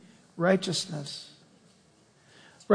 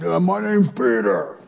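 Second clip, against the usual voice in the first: dopey Peter voice. Pretend. Preacher imagining Peter as a big dumb fisherman. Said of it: "I wonder what he had sounded like".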